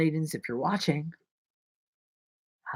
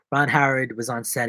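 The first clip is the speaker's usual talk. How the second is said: ordinary talk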